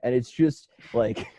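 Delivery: ordinary talk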